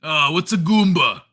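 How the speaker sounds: loud crude cartoon neighbour voice; Carl from Aqua Teen. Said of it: "it was a very Carl".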